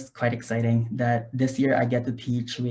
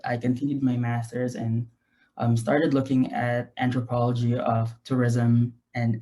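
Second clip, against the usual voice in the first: usual speaking voice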